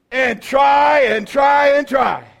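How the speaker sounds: slow exaggerated shouting of the effort; drawn-out shout. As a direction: belt out every try, dragging it out. Mocking endless trying on guitar.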